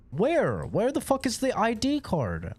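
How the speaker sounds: high exasperated shout; frustrated yelling. Real, not acted. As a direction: yell at the game in frustration. Frantic searching, loud high.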